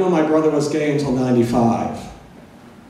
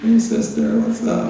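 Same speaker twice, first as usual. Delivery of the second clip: much higher, flamboyant hey sister, breathy what's up; 10 semitones higher than usual. Retold or imitated. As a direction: ham up the camp lingo. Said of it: "They're like".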